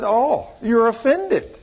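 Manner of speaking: slow, laughing "oh, you're offended"; laughing tease. Retold or imitated. Preacher teases the offended woman, slow and laughing. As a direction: chuckle it out slowly, teasing her.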